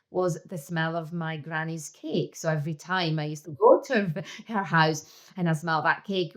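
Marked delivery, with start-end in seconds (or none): none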